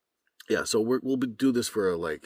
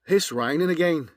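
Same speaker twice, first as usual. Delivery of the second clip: a lilting Irish accent, pitched higher and louder; 9 semitones higher, 6 dB louder than usual. Shown as a demonstration. Voice actor shows his Irish accent for the camera, lilting Irish. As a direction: put it in a lively Irish accent, said forward in the mouth. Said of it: "Now from Ireland".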